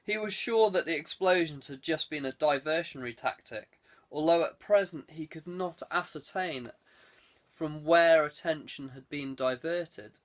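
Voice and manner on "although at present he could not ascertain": ordinary delivery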